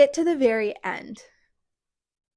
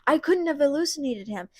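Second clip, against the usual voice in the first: mild panic; 6 semitones higher than usual. Retold or imitated. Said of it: "So then Andrew starts freaking out".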